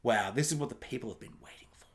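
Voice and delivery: hushed mocking whisper of studio hype. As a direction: whisper it like a fake reverent promo. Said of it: "they're like".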